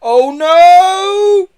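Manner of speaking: very high, loud, long cry; alarmed wail. A narrator's reading. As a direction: cry out as if flung through the air. Boy being hurled through window.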